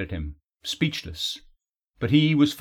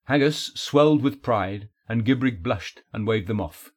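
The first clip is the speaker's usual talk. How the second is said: usual speaking voice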